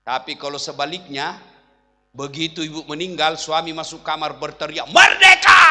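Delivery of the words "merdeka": a big triumphant yell at the top of the voice; joyful shout. Retold or imitated. Husband cheering loudly over his late wife. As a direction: bellow it like a victory cry.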